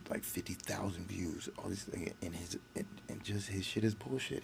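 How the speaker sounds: usual speaking voice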